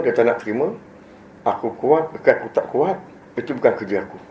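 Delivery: ordinary delivery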